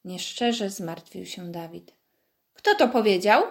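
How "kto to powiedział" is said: shout. Acted. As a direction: call it out sharply, caught out. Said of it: "krzyknął zawstydzony".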